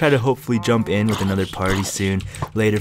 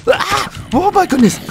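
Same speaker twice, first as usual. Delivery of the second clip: very high, loud shriek; startled scream; 8 dB louder, slower than usual. Real, not acted. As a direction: scream out at a sudden scare. Jump scare in the game.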